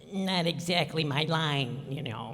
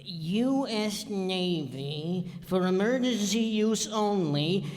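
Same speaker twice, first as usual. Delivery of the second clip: usual speaking voice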